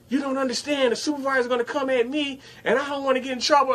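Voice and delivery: high, rushed worried coworker; mock frantic coworker; anxious coworker. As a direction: hurry it out, voice up.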